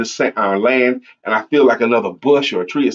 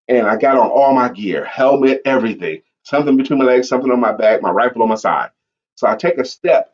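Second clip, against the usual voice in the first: usual speaking voice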